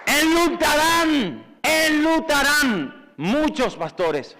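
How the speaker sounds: slow, drawn-out prophetic warning; solemn preacher's decree; grave prophecy. Acted. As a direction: let each word fall heavy and slow.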